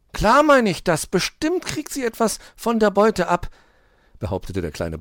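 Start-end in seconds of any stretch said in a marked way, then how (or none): 0.1-3.5 put-on high, loud child-like hedgehog voice; excited little hedgehog. A narrator's reading. Borstel tells Hoppel about the crooks, excited. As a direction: report the crime in a high, urgent voice.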